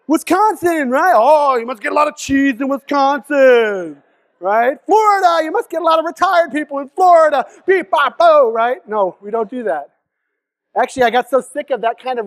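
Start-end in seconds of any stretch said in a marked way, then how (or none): 0.1-4.8 loud, slow mocking voice; mock tourist question. Mocking strangers who ask about her home, loud. 4.9-8.9 mock tourist question. Mocking what people ask her.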